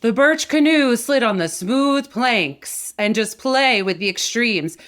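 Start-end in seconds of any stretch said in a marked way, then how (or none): 0.0-2.9 louder, slow, full-throated reading; loud, slow projected read; full open voice. Shown as a demonstration. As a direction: project the sentence at full volume. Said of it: "But just saying".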